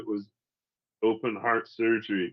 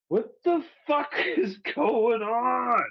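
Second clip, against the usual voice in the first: much higher, slow, half-laughing cry of outrage; mad disbelief; 11 semitones higher, slower than usual. Retold or imitated. Heart patient demanding answers from the doctors, outraged. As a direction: wail it out, laughing through the fury. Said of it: "I was just mad".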